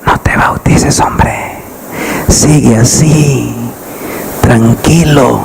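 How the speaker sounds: the devil coaxing softly into your ear; tempting whisper that slows to a lull; sly devil whisper. Acted. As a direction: whisper in the ear, sweet and subtle, then slow. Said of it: "el diablo tiene una voz tan sutil, tan dulce, que siempre habla al oído".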